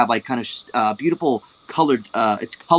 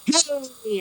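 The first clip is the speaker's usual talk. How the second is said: sharp, much higher yelp; goblin cook's "Hey!"; 12 semitones higher, 4 dB quieter than usual. Acted. Goblin chef catching tofu mid-air, high yelp. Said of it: "catches a piece of tofu in his hand and goes".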